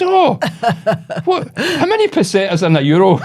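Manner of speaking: high, loud confused old-lady impression; mock old lady, high and baffled; wee old woman. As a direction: imitate a bewildered old woman, starting right at the top. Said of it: "this wee old woman".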